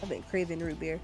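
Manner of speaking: ordinary talk, nothing marked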